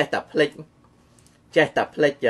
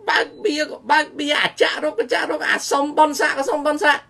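mocking high voice; 10 semitones higher than usual. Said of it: "លើកលែងតែអ្នកម្នាក់ម្និចមួយទេ".

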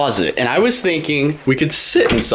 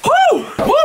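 excited whoop. Real, not acted. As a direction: whoop with relief. Escaping the box.

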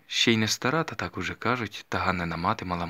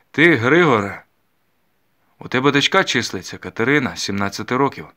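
1 bossy overseer; 4 semitones higher, 8 dB louder than usual. A narrator's reading. Overseer commanding at the window.

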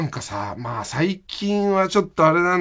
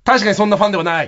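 put-on quick, louder blurt as a little boy; loud child's heckle, imitated; 3 semitones higher, 5 dB louder, faster than usual. Front-row schoolboy calling up to the performers, imitated loud blurt. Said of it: "それがまあまあ大きい声で".

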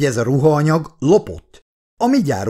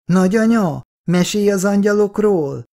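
put-on higher child voice, wheedling grandma; coaxing little boy; 7 semitones higher than usual. A narrator's reading. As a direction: sweet-talk her like a small boy. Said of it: "Petike hízelegve bújik a nagymamához". (hízelgő kisfiúhang)

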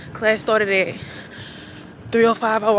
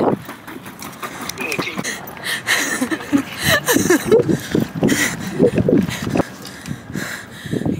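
long breathy laugh; 7 semitones higher than usual. Real, not acted. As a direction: burst out laughing. Laughing at a friend's remark.

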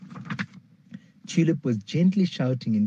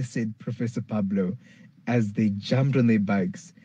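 plain reading voice

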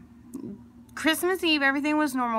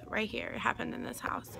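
hushed real whisper to the camera; whispering that it happened right here in this house; 9 dB quieter than usual. Real, not acted. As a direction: whisper it like a secret you're scared to say. Secret true ghost story reveal.

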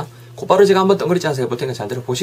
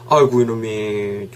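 slow, drawn-out mock grumble; muttered exasperation; 3 semitones lower, slower than usual. Older brother grumbling at his studying sibling, mock exasperated. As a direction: grumble it slowly under restraint.

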